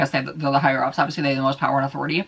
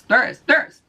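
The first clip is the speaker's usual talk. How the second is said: mock-emphatic insistence; 8 semitones higher, 4 dB louder than usual. Real, not acted. Insisting on echelons, rising each time.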